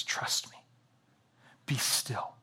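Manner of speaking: hushed breathy reassurance; whispered comfort. Retold or imitated. God calming a worried believer, whispering trust me. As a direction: whisper it close and gentle. God hushing, echoing the father voice.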